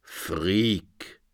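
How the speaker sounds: low, soft, ominous pronouncement; prophesying. A narrator's reading. Interpreter prophesies to Phoenix, low ominous. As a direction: intone it like doom. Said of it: "prophezeite der Traumdeuter".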